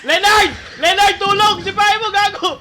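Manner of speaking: high loud urgent yell; shouting for help. Real, not acted. Calling teammate for help.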